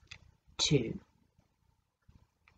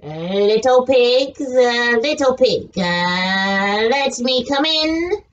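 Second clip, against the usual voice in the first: wolf voice; 9 semitones lower, slower than usual. Acted. Wolf calls at straw house.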